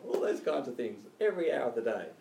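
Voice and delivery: ordinary talk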